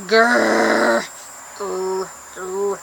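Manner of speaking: repeated soft playful growls; growling. Pretend. Growling at friends out of boredom, soft growls. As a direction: growl it three times. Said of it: "I'm like".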